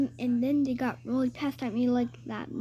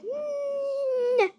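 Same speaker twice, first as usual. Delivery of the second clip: disappointed whimper. Retold or imitated. Disappointed the cashier doubts queen.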